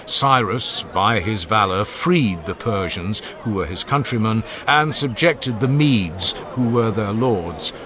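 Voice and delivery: plain reading voice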